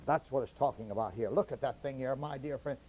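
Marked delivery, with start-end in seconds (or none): none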